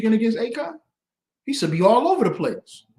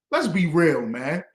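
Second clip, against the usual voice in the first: ordinary delivery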